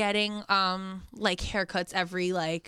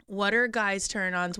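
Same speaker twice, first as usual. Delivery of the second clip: usual speaking voice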